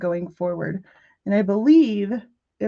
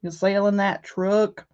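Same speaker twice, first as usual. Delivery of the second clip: put-on country accent; slower than usual. Another neighbor asking about the truck, Southern country accent. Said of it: "these people want this truck".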